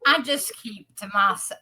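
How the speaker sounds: usual speaking voice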